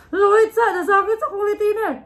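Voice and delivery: high, loud laughing talk; laughing hard. Real, not acted. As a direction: laugh through the words. Laughing during the challenge.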